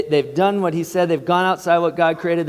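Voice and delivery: normal talking voice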